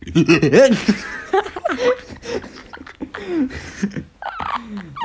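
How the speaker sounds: high drawn-out laugh; long laugh. Real, not acted. Guest laughing at being called dolphin-like. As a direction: laugh hard for a while.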